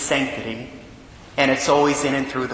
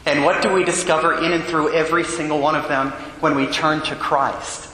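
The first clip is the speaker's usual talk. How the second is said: ordinary talk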